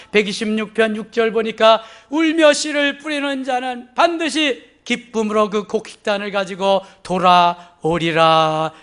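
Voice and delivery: loud, declaimed scripture promise; full-force preaching. Real, not acted. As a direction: proclaim the psalm to the whole congregation. (온 힘을 다해 선포하는 설교)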